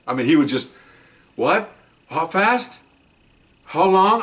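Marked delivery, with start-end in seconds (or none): 1.3-4.2 high, questioning mock disbelief; retold dismay. Officer hears bad news about his truck by phone, imitated dismay.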